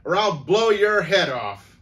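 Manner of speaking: loud, high-pitched shouted threat; snarled threat. A narrator's reading. Grandmother threatens intruder with imaginary pistol, snarled threat. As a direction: bellow the threat high, as if breaking up a dogfight. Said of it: "she snarled".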